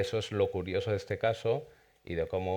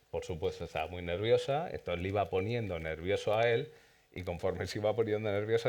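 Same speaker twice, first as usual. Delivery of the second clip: ordinary talk, nothing marked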